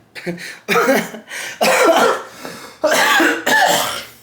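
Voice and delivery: very high, loud cackle; long high-pitched laughing fit; hard laughter. Real, not acted. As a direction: crack up helplessly, long wheezing bursts. Cracks up at own joke.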